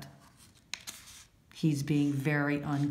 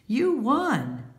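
bright surprise; 9 semitones higher than usual. Acted. Good sport congratulating winner.

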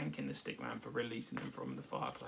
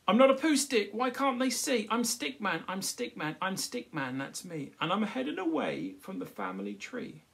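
high, loud, indignant stick voice; Stickman's protest; 7 semitones higher, 9 dB louder than usual. Acted. Stickman protesting to the crowd, high and insistent. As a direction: insist you're Stickman, not a poo stick.

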